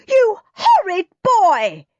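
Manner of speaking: loud, high, slow furious shout; yelling. A narrator's reading. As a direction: scream it at the naughty boy. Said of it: "yelled Mum".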